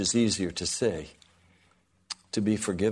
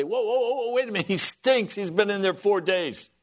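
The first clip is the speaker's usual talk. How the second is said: much higher, protesting bystanders; hammed-up horrified mourners; mock alarmed crowd; 8 semitones higher than usual. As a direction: throw up your hands, pitch jumping up. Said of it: "And they say".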